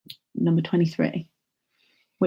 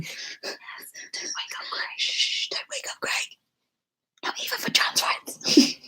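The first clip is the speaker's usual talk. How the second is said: whispering; 5 dB quieter than usual. Real, not acted. Don't wake sleeping Greg.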